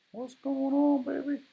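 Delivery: very high squeaky voice; silly coaxing voice. Acted. Teacher coaxing his broken stylus in a high silly voice. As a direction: coo at the stylus in a high cartoon voice.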